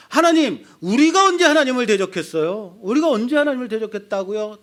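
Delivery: high, loud demanding people; indignant demand. Acted. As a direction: argue back at God, challenging. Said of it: "따져 묻죠". (높고 크게 따져 묻는 백성)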